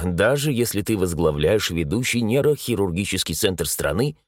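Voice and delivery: usual speaking voice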